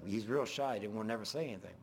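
ordinary talk, nothing marked

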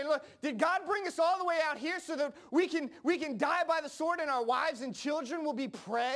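acted-out aggrieved protest; indignant accusation. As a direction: demand an answer from the leader. People acted out accusing Moses.

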